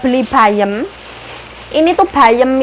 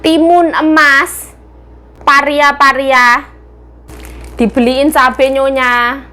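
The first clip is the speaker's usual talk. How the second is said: slow sing-song listing; 3 semitones higher, slower than usual. Acted.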